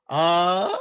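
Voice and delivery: "A" held as a very long aaah; prolonged three-beat "A", sounded aaah; extra-long vowel. Shown as a demonstration. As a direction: stretch "A" over three beats, an open ah. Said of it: "three matra in Sanskrit".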